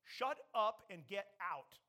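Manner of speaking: loud, slow, forceful command; stern order to a demon; emphatic rebuke. Acted. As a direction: hit each word hard and slowly. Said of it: "with em-, emphasis, he said".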